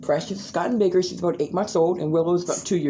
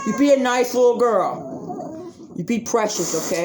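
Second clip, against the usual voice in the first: stern dog talk; 5 semitones higher than usual. Real, not acted. Telling the dog to behave.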